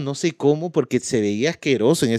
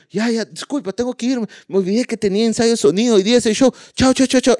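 Brian rushing off; 9 semitones higher than usual. Retold or imitated. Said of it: "Y él".

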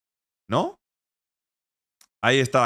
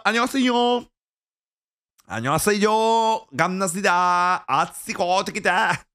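higher, fast playful Korean greeting; a higher, quick mock Korean hello; 4 semitones higher, faster than usual. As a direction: rattle off Korean words showily.

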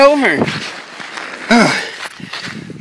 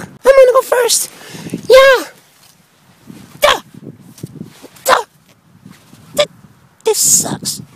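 high Mario voice. Acted.

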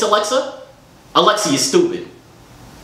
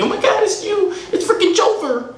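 high squealy fan voice mocking the name; mock fan squeal. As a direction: gush like a fan who gets the name wrong.

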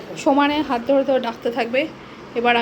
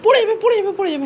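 high, loud, quick-fire warning; real alarm, raised high and loud; urgent warning; 7 semitones higher, 4 dB louder, faster than usual. Real, not acted. Owner warning that the pet will fall, urgent.

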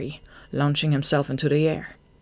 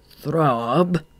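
questioning, with a rising end; suspicious prompting; 3 dB louder than usual. A narrator's reading. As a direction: press the guilty follower for an answer. Leader pressing his guilty follower.

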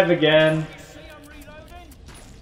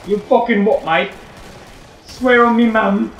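hammed-up Cockney, pitched up; mock Cockney; 6 semitones higher than usual. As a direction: put on a cheeky mock Cockney. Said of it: "Why are you Cockney?".

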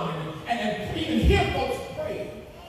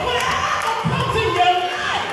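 rising, loud, high preacher's climb; preaching at full force; 8 semitones higher, 4 dB louder than usual. Acted. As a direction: build the congregation up to a roar.

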